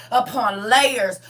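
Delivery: ordinary talk, nothing marked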